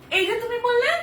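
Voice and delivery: high, loud, incredulous question; startled, voice jumping up; surprised. Acted. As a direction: challenge him in happy disbelief. Surprised by husband's early arrival.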